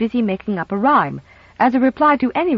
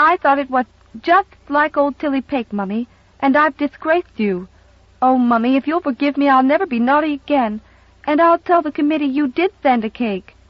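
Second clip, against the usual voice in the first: put-on high, tearful child begging forgiveness; a small girl pleading with her mother; pleading little girl; 6 semitones higher than usual. Little girl begging mother's forgiveness, high and pleading.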